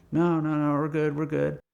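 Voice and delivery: high, insistent retold reassurance; brushing off. Painter declining the owner's help, brushing off. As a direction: bat the offer away.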